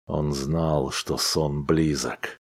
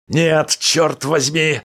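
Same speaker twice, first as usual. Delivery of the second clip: slow, higher, louder outburst; husband yelling at his sleepy wife; cry out; 9 semitones higher, 4 dB louder, slower than usual. A narrator's reading. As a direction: shout it, fists shaking. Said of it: "вскричал муж".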